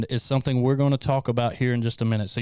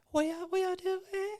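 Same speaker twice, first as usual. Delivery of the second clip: very high, soft and slow squeak; Lori; 9 dB quieter, slower than usual. Retold or imitated. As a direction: mimic someone far too quiet to hear. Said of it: "She's so quiet".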